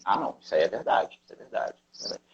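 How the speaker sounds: fading to a whisper; accepting brain. Retold or imitated. The brain accepts the statement, fading into a whisper. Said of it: "Aí o cérebro fala assim".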